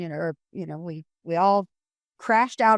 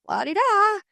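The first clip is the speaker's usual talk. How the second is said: high carefree sing-song la-di-da; breezy, unbothered little lilt; singing out la-di-da like nothing's happening; 11 semitones higher than usual. Pretend.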